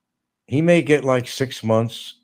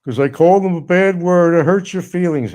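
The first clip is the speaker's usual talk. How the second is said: much higher and louder mocking whiny voice; mocking whine; 11 semitones higher, 8 dB louder than usual. Host mocks the complaining inmate in a high, loud whine. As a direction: sneer it up high like a crybaby.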